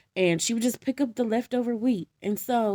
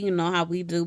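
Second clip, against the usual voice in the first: usual speaking voice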